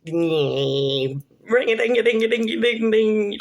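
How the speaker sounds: high, sing-song imitation of a ringing bell; mock alarm bell. Woman telling how she reacted to scammer, mock ringing. Mimics alarm bells going off.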